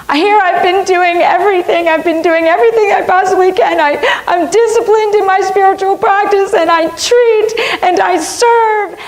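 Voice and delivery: desperate wife pouring out her failures to her husband, tearful; mumbled through tears. Retold or imitated. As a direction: plead through sobs in a high tight voice. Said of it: "I'm mumbling through my tears and slobber".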